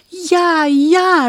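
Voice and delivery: bursting with joy; excited cry. Acted. Princess crying out yes to the witch, high and excited. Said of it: "riep het prinsesje".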